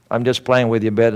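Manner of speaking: ordinary delivery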